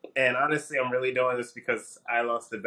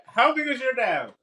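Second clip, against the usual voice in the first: high, startled question; shrill disbelief at the dab; alarmed high squeak; 13 semitones higher than usual. Real, not acted.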